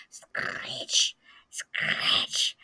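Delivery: whispered scratch, scratch like a hoe; whispered hoe noise. Acted. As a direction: breathe the scratching sound tensely. Sound of a hoe nearby.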